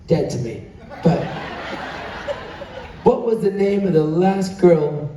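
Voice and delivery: ordinary talk, nothing marked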